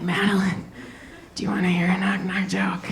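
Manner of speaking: put-on soft whispered child's question; toddler whisper. Toddler whispering to his nanny in the dark, hushed.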